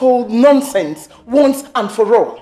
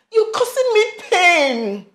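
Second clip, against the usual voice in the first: anguished complaint; 5 semitones higher than usual. Acted. Mother guilt-trips son.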